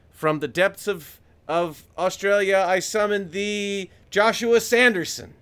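loud, high, slow summoning call through cupped hands; hands over mouth echo. Pretend. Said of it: "I put my hands over my mouth and created, like, an echo effect".

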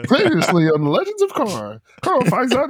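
put-on high, old radio announcer read; old-timey announcer voice. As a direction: do a vintage "previously on" narrator. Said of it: "that guy with that voice, that old-timey voice".

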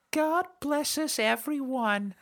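put-on very high, slow childlike squeak; Tiny Tim. Host closing the review with a childlike Tiny Tim line.

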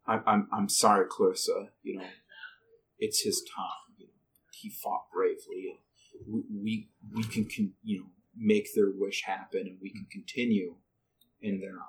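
quiet, low, tender condolence; soft consoling voice. Acted. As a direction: comfort a grieving girl. Goklok consoles Clarissa.

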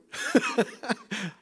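a high mocking laugh. The men scoffing at David, a high mocking laugh. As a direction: laugh at the very idea.

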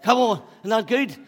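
high, loud and exhilarated; excited calling out. Real, not acted. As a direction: shout it to the whole room. Calling out after the chase.

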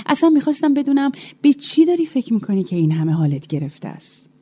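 usual speaking voice

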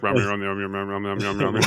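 rapid-fire low name repetition; low, blurred, tumbling Pokémon babble; really fast. Pretend. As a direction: gabble it as a speedy Pokémon chant. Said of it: "I'd probably just go really fast".

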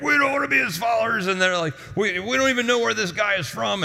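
high, huffy, fast protest; mock the leaders' offended huff; offended leaders protesting, pitched high; indignant leaders.

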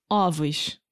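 ovos with an open ó, ɔvos; ovos, the first O opened wide; open vowel plural. Shown as a demonstration. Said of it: "você tem que abrir a vogal".

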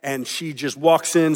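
usual speaking voice

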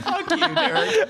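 high laughing mock curse; laughing insult. Friends laughing while cursing Derek. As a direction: curse him through laughter.

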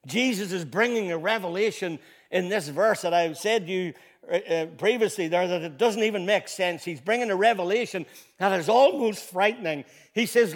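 loud, high, building preacher's voice; rising pulpit fervour; full-force preaching. Acted. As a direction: drive it home to the congregation.

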